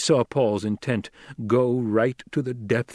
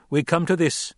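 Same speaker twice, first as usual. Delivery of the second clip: put-on young duke addressing tribe; 6 semitones higher than usual. A narrator's reading. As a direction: challenge the crowd with rising authority. Paul addresses the throng.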